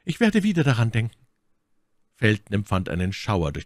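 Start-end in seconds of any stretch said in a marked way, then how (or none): none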